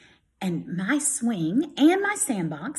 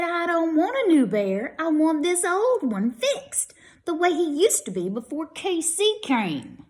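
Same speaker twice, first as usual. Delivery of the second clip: put-on much higher, louder protesting child; upset child; 9 semitones higher, 4 dB louder than usual. Grady protesting he wants his old bear, upset. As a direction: whine it like a stubborn kid.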